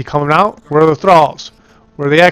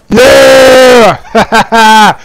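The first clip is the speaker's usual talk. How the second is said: loud triumphant yell; victory shout; 6 semitones higher, 7 dB louder than usual. Real, not acted. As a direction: cheer the win out loud. Victory cheer.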